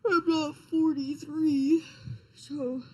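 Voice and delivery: words stretched through a yawn. Real, not acted. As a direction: talk through a big yawn. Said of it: "Sorry, I'm yawning".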